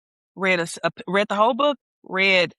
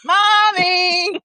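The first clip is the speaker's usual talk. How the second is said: high, louder close-up call; imitating a child calling mommy; 9 semitones higher, 5 dB louder than usual. Friend retelling the daughter's third call, high and loud. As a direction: call mommy right behind her, like a toddler. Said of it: "when she was right behind you".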